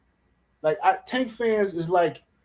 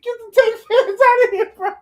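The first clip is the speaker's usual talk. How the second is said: high-pitched words squeezed out through crying laughter; can barely talk for laughing; helpless laughter; 6 dB louder than usual. Real, not acted. Roasting Tank fans, words squeezed through laughter. Cracking up at own joke.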